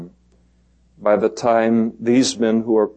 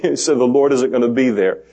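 ordinary delivery

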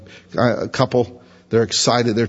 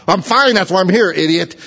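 higher, mock snippy retort; sarcastic patient; 6 semitones higher than usual. Patient in bed snapping at visitor, mock sarcastic. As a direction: fire back at the silly question.

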